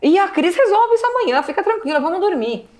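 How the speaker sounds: high, tearful comforting voice; forced reassurance. Retold or imitated. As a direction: hold it together while soothing. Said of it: "só que era assim, era um".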